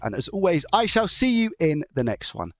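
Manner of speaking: slow, high, bright sign-off voice; video outro. Acted. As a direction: YouTube outro delivery. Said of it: "doing a bit of an outro for the video".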